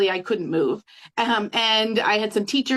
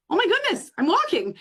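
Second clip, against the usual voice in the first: pitched much higher, a replayed gasp of wonder; retold amazement; 9 semitones higher than usual. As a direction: relive the moment you could suddenly walk. Retold amazement at her healed back.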